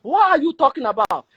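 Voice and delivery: exasperated, high-pitched challenge; real irritation. Real, not acted. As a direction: demand an answer, pitch jumping up. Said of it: "it's highly irritating".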